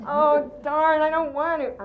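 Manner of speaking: put-on loud, high-pitched kid's whine of protest; protesting child. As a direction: act a child balking at bedtime, loudly. Acting a child resisting bedtime.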